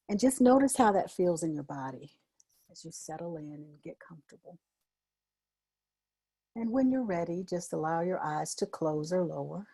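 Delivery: soft, settling meditation voice; guided meditation. Real, not acted. Host guiding group to settle and close eyes, soft meditation voice. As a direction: ease them gently inward, pausing between phrases.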